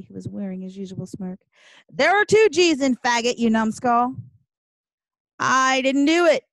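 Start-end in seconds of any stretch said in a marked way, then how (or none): 1.9-4.1 loud, raised-pitch correction; indignant yell. A narrator's reading. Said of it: "Then yells across the hall". 5.4-6.4 loud, high, drawn-out denial; shouting back. A narrator's reading. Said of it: "Kevin shouts back".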